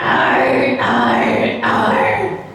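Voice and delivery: rasping, shouted sea captain arr. Shown as a demonstration. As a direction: bark it like a hoarse old salt. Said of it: "Sounded like a, a sea captain with, uh, in dire need of a lozenge".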